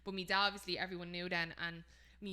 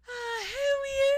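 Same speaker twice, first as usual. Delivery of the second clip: loud, drawn-out squealed greeting; real high-pitched; 12 dB louder, much slower than usual. Retold or imitated. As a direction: imitate dad's shrieky, delighted hello. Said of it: "he goes real high pitched".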